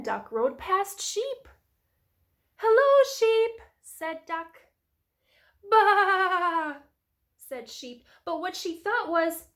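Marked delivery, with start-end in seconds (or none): 2.6-3.6 Duck calling hello. A narrator's reading. Duck greets Sheep in story. 5.7-6.8 loud, high, long bleat; sheep baa. A narrator's reading. Sheep noise in story.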